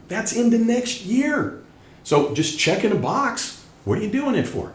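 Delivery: high-pitched, worked-up exasperation; a little excited. Real, not acted. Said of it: "I'm getting a little excited".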